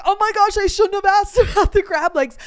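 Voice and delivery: high, loud retold panic, breaking into a laugh; hammed-up flustered apology, high and loud; flustered friend. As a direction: imitate a friend's alarmed regret. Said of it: "And she's like".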